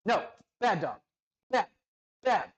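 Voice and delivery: sharp, loud, high scolding of the dog. Real, not acted. Owner scolding his barking dog, loud and sharp. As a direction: bark a firm "bad dog" at her.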